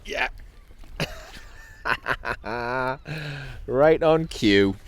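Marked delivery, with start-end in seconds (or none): 1.8-3.6 chuckling "ha-ha-ha" with drawn-out end; delighted laugh. Real, not acted. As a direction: laugh at the perfect timing. Fish bites right on cue.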